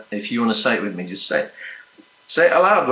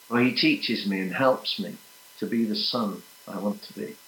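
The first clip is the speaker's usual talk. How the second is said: intimate prayer; 3 semitones lower than usual. Acted. Prayer grows softer and more intimate.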